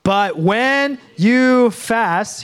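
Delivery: But when you fast, very slow, you held long; slow group reading. Shown as a demonstration. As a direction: lead the room slowly, leaning on you. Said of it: "say it with me".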